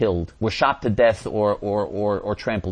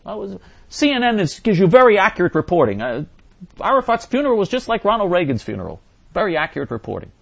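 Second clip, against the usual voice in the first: sarcastic; 8 semitones higher, 5 dB louder, faster than usual. Real, not acted. Sarcastic jab at CNN.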